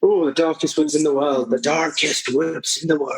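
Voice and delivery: badly faked Scottish lilt; bad Scottish accent. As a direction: put on a hammy Scottish accent. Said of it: "that really bad Scottish accent".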